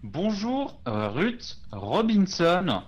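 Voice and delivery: ghost-calling voice. Acted.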